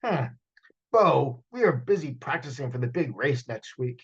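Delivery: a hint of a stern busy uncle. Uncle brushes off Bo, lightly stern. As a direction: brush the boy off. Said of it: "he replied".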